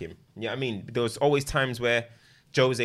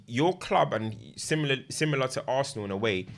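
ordinary talk

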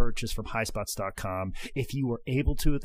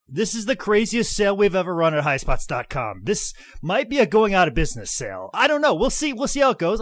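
excited; 7 semitones higher, 5 dB louder than usual. Real, not acted. Excited sales pitch.

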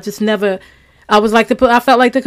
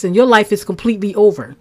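usual speaking voice

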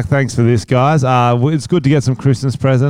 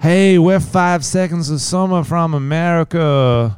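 higher, slow hammed-up American drawl; mock American accent; 5 semitones higher, slower than usual. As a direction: play a fake American pop star. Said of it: "pretending to be American".

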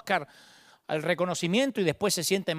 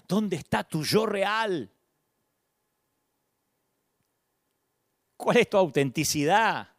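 gentle question; 2 semitones higher, slower than usual. Acted. Dios invitando con gracia.

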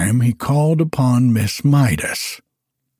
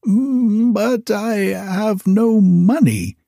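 put-on much higher, plaintive old wizard voice; fretful wizard; 9 semitones higher, slower than usual. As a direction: whine about the lost dog as the wizard. Wizard voice, high.

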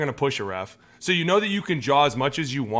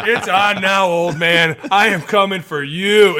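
raised, loud mock challenge; acted loud threat; 6 semitones higher, 9 dB louder, slower than usual. Player challenging the old man referee, mock loud threat. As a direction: call out the threat, slowing at the end.

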